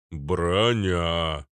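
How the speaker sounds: drawn-out droning boom; hooting character voice; Arnold. A narrator's reading. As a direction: hum it out like a slow giant. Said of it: "прогудел Арнольд".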